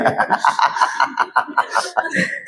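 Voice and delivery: long, soft, breathy chuckling; sheepish laugh. Real, not acted. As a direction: keep chuckling quietly at your own slip. Laughs at forgotten microphone.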